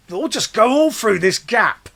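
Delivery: eager French knights. Retold or imitated. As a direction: ham up the rash French knights. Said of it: "And the French go".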